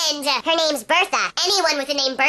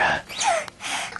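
high, soft gasp of fright; acted frightened gasp; startled gasp; 12 semitones higher, 6 dB quieter than usual. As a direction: catch the breath in alarm. Startled by the ghost again.

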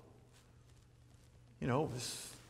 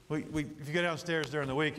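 normal talking voice

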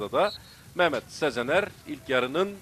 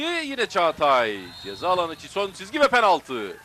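excited commentator; 5 semitones higher, 8 dB louder than usual. Real, not acted. Penalty call in live commentary.

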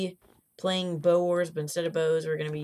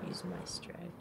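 whispering; 12 dB quieter, faster than usual. Real, not acted. Secret aside during game.

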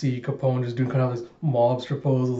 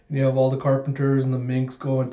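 normal talking voice

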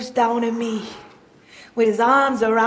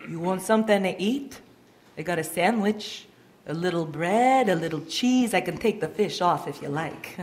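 soft and lower, a little quick; kindly offer; 6 semitones lower, 6 dB quieter than usual. Acted. Jesus leaning down to the boy, soft and kind. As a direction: offer it gently to a friend.

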